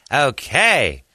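high, slow, swooping mimicry; Jon Lovitz voice. People doubt it's him as he talks, showy mimicked voice. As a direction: stretch the words in showy exaggerated impression. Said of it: "he talks like this".

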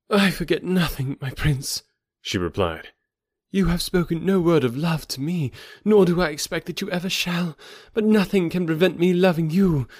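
put-on devoted young woman. A narrator's reading. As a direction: pledge yourself to your prince. Thuvia pledges devotion.

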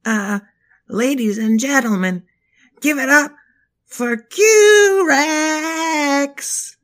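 loud, high emcee hype; hype announcer. Acted. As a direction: shout the intro like an emcee.

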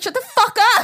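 high, loud mock snap; teasing loud snap at a sweet husband; mock retort. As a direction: play her brushing him off, loudly. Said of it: "and you're like".

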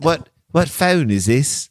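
slow, put-on British accent; attempted British accent. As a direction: try a British accent, drawing the question out. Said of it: "trying to do a British accent".

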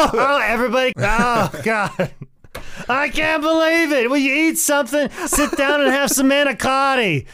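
high, loud exasperated Italian relative; wailing, hammed-up Italian-accented scolding. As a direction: nag like an over-the-top Italian relative. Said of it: "sound like one of Ashley's relatives".

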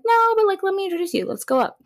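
very high, insistent mock voice; pushy eager friend. Friend urging them to approach, high-pitched insistence.

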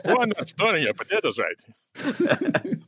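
mock Russian accent. Joins the Russian accent bit.

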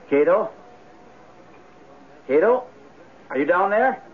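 calling down the stairs; calling from far away. Acted. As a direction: call out toward the basement. Calling down into the basement.

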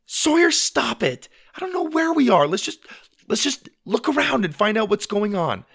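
put-on high, loud, flustered girl; urgent high-pitched scolding; exasperated sister. A narrator's reading. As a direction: cut off a panicking brother. Sister halts brother's panic.

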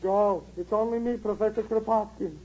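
put-on low Russian-accented old professor; gruff Russian accent, unbothered. Elderly Russian neighbour begging for pots, in a low Russian accent.

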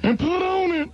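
prank-calling the manager as the owner; Joe impression. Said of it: "I began to be able to do this impression so well".

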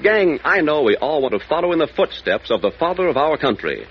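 normal talking voice

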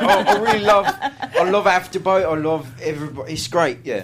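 high, loud mock Cockney; Cockney accent. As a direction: ham up a Cockney chat. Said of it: "a Cockney accent right now".

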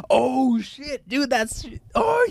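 high shocked yelp. Retold or imitated. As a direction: react in sudden disbelief. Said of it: "he's like".

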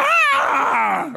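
sudden very high, loud yelp of pain; imitating a scream of pain. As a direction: yelp as the knee gives. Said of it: "And I went".

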